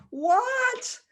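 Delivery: high, loud incredulous squawk; hammed-up indignant kid; mock outrage. Daughter hears she gets no ice cream, outraged.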